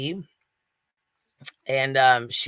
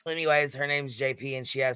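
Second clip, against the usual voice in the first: ordinary delivery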